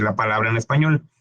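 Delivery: usual speaking voice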